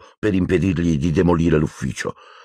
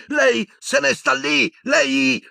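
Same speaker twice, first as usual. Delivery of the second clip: much higher, slow broken-off yell; much slower than usual. A narrator's reading. Boy yelling at headmaster, cut off. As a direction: choke on the fury mid-word.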